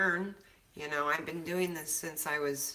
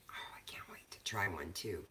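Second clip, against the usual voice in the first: whisper; 9 semitones lower, 8 dB quieter than usual. Real, not acted. Excited whisper about soap.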